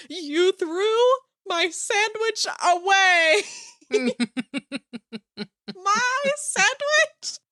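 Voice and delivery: high, slow, shrill-free mock meltdown, far above normal; Ross losing his mind. Ross to the coworker who threw out his sandwich, high drawn-out mock fury. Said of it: "And he was like".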